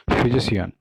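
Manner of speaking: फिजिशियन ending in "si-an"; फिजिशियन in four syllables; common mispronunciation. Retold or imitated. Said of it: "इसको लोग सियान पढ़ते हैं".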